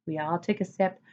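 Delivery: ordinary delivery, nothing marked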